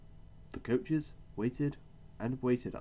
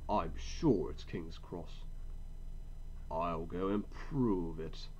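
slow, lower stubborn grumble; grumbling; 4 semitones lower, much slower than usual. A narrator's reading. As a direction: mutter it to himself. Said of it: "still grumbling".

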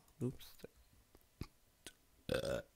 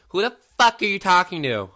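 loud, much higher annoyed mom; mom yelling; 9 semitones higher, 12 dB louder than usual. Retold or imitated. Mom walking by his room, loud annoyed yell. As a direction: shout through the door like an irritated mother. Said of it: "and she'll be like".

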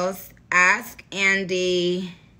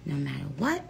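soft, sincere goodbye getting softer; softer and softer, fading to a whisper; tender goodbye; 8 dB quieter than usual. Real, not acted. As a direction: reassure the fans gently.

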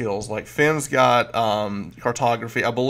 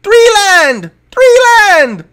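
kobold lookout yell; 10 dB louder, slower than usual. Acted.

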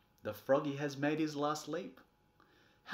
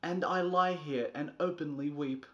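usual speaking voice

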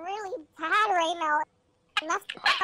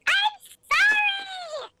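high theatrical cry of offense; mock offended outburst; much slower than usual. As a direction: play it wounded and outraged. Mock-offended reply to request.

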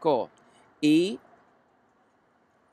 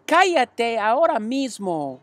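higher, slow pleading voice; upset girl; 7 semitones higher, slower than usual. A narrator's reading. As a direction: Fern begging her brother to stop. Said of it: "gimió Fern".

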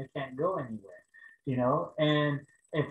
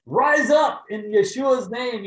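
slow booming shout, much higher and louder; mighty healing command; 10 semitones higher, 10 dB louder, slower than usual. Pretend. Preacher imagines commanding the paralyzed woman, booming shout. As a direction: command her to rise like an apostle.

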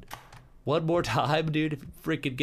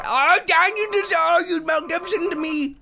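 excited squeal; 7 dB louder than usual. Acted. Excited outburst at partner.

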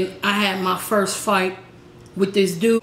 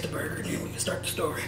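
whisper; 3 semitones lower, 12 dB quieter, faster than usual. Real, not acted. Quick aside before tasting.

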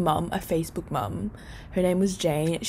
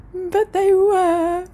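high, slow and loud, stretched out in delight; thrilled relief; 12 semitones higher, 6 dB louder, much slower than usual. Real, not acted. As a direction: let it burst out, drawn out with joy. Relief the tickets were there.

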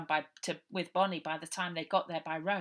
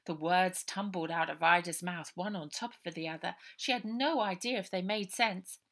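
ordinary delivery, nothing marked

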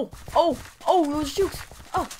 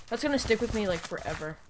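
ordinary talk

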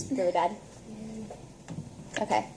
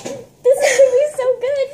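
laughing; 11 semitones higher than usual. Real, not acted. Laughing at gross smoothie.

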